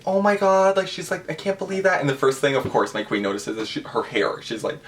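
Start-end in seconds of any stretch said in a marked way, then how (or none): 0.0-2.0 much higher, mock-astonished exclaim; lightly played delighted disbelief; imitating surprise. Selena seeing last year's photos, mock surprised and high.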